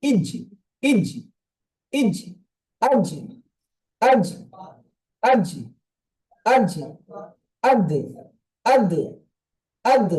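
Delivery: ইজ, আজ and আদ with an echoing final stop; qalqala drills. Shown as a demonstration. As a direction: bounce every final consonant. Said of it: "জাবার দেন বা জের দেন".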